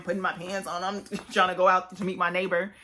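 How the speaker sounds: ordinary delivery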